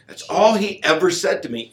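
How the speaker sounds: ordinary talk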